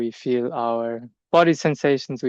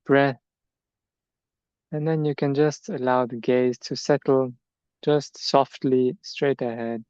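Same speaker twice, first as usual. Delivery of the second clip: guided meditation. Acted. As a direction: ease them gently through each step, leaving space. Leads standing meditation.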